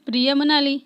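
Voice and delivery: usual speaking voice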